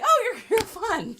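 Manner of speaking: high, loud, laughing approval; mock delight. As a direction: gush through a laugh as an imitated fan. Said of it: "or I get the".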